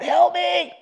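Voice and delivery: high, fake cry for help; screaming for help. Boy pretending to be choked, calling mom, high mock scream. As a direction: scream it out like the little brother in trouble. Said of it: "I'd start screaming out".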